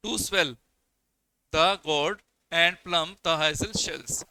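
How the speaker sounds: very slow, each foot set apart; scanned reading. Shown as a demonstration. Teacher slowly scanning the poem's line to students, every beat marked. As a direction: read the line foot by foot for the class. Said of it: "अब देखिए यहाँ पे कितने फिट हुआ".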